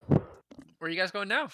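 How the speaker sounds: ordinary talk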